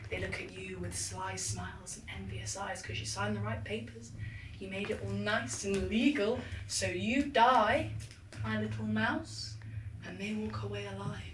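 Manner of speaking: soft menacing murmur; sinister hush. Acted. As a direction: hush it like a threat.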